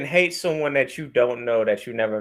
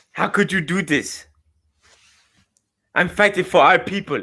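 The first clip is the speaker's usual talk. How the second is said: put-on African accent. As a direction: play a wronged son confronting his father, African accent. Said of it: "I have a good African voice".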